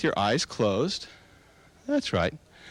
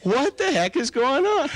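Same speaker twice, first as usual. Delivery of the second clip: amused disbelief; 12 semitones higher than usual. Shown as a demonstration. Hypnotist acts out watching a comedy, delighted. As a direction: marvel at the clown's antics. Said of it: "you already start to laugh".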